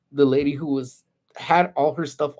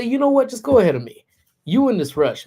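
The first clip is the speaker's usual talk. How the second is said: higher, a bit louder, sarcastic go-ahead; retold annoyed telling-off; 8 semitones higher, 3 dB louder than usual. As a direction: mockingly wave her ahead. Retold telling a pushy lady off.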